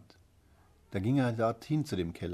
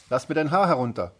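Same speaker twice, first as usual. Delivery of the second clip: loud call that starts high; 5 semitones higher, 9 dB louder than usual. Acted. The prince asking Rapunzel from below, calling loudly. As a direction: shout the request up the tower. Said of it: "als der Königssohn kam und rief".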